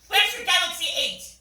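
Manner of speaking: indignant demand. Acted. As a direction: fire the question at him in disbelief. Indignant date scolding phone.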